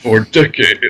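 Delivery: announcer voice; commercial voice. Retold or imitated. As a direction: ad read delivery. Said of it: "I sound like a, a commercial".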